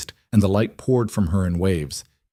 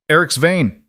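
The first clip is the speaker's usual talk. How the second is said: higher, louder hail up to the gate; calling up; 7 semitones higher, 4 dB louder than usual. Acted. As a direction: call the name up the wall. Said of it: "he called up".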